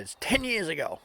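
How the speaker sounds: high-pitched indignant retort; mad older lady. Retold or imitated. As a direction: answer huffily in the old lady's high voice. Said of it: "getting madder and madder".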